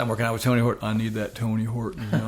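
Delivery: put-on lightly done Gainesville accent; rough Gainesville accent impression; [name]. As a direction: imitate a Gainesville musician, drifting toward Forrest Gump. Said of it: "I sound more like Forrest Gump".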